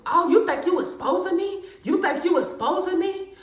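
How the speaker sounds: lightly voiced defensive mother; mother getting defensive. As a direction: imitate mom snapping back defensively. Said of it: "So she got mad".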